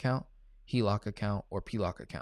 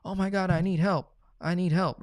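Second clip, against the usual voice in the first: pitched up high and louder, imitating a pleading client; mock desperate plea; 9 semitones higher, 5 dB louder than usual. Coach mimicking a client's email, mock frantic plea. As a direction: ham up a frantic "I need help".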